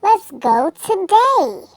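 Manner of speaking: high, slow, squawking parrot-like voice; squawky bird voice. Acted. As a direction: squawk it out like a little lovebird. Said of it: "in a squawky voice".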